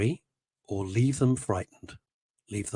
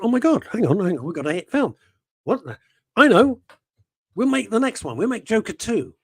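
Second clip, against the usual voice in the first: higher, louder giddy studio exec; mock excited; 8 semitones higher, 4 dB louder than usual. Studio execs realising they have a hit, mock excited. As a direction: ham up greedy excitement. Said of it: "they went".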